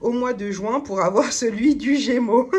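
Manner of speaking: amused real laughter; laughing. Real, not acted. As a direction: giggle out the end of the line. Jokes about ordering again.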